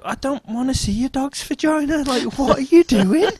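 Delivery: high-pitched appalled protest; recoil, horrified and incredulous; squeaky disgusted disbelief; shocked. Retold or imitated. Shocked by dog display.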